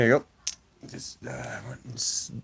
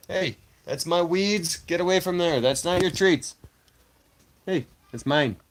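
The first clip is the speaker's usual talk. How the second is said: scolding the cat; 6 semitones higher, 4 dB louder than usual. Real, not acted. Streamer scolding cat at his treats, loud scold. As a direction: shoo the cat off firmly.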